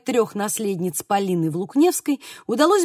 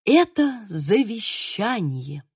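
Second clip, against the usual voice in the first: slow, reverent proclamation; inspired; much slower than usual. A narrator's reading. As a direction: draw it out as a momentous announcement. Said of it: "вдохновенно произнёс он". (вдохновенно, торжественно)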